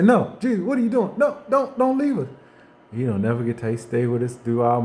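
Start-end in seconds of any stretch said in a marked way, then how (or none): 0.0-2.3 high, frantic begging; mock panicked cry, much higher; panicked plea. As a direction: beg him not to go, voice climbing high. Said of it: "We say".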